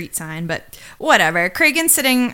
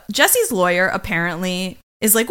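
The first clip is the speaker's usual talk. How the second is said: normal talking voice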